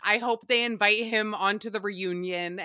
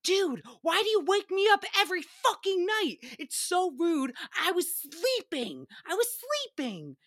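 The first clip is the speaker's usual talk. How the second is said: higher mock annoyed girlfriend; annoyed snap; 7 semitones higher than usual. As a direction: snap back half-asleep, lightly acted. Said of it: "[name] snaps up".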